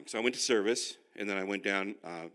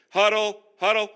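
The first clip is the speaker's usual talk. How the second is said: higher, louder call of "Huddle"; 9 semitones higher, 13 dB louder than usual. Retold or imitated. Jesus calling disciples together, loud coach-like call. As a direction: gather the team like a coach.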